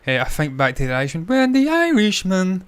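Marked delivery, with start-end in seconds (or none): 1.3-2.6 high, drawn-out robotic monotone; slow, high-pitched text-to-speech voice; synthetic voice. Acted. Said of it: "like a, what do you call those, uh, voice, synth-, uh".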